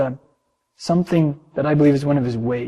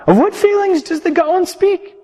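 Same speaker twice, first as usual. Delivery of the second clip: much higher, wondering voice; puzzled pupils; 4 dB louder than usual. Acted. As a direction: ask in disbelief. Pupils question their master.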